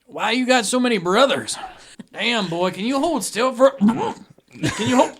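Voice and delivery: put-on high, loud drunk about to be sick; befuddled drunk, breaking off mid-sentence; Crazy Eddie. Drunk begging the bartender to hold still, loud queasy voice. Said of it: "Crazy Eddie is the town drunk".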